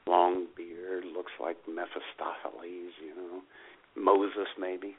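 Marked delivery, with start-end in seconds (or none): none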